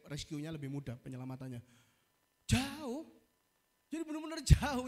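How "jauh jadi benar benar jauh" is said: emphatic. Real, not acted. Stressing how far from land.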